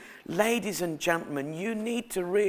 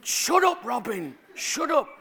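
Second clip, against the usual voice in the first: much higher, mock-panicked hush; retold urgent hushing; 7 semitones higher than usual. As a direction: try desperately to silence your child. Embarrassed father hushing his child.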